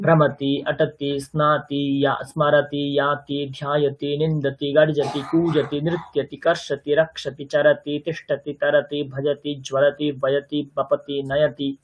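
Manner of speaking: even, monotone drill rhythm; verb-list recitation. Shown as a demonstration. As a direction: recite each form on one steady pitch.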